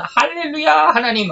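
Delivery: high, drawn-out hallelujah; acted burst of worship, slow and high-pitched; exultant praise. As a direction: cry out praise to God, stretched and lifted high. Acting out Habakkuk's praise.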